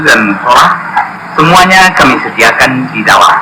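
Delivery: formal public address read to travellers; PA announcement. Acted. Rest stop announcer welcoming bus passengers, formal announcement voice.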